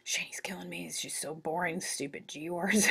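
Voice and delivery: unvoiced sleepy whining; tired student complaining under breath; mock cranky mumble; groggy student whisper. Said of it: "You're like".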